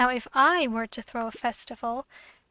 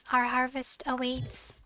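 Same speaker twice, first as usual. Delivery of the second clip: ordinary talk, nothing marked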